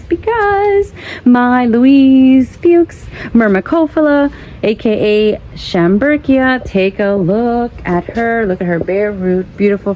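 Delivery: ordinary talk, nothing marked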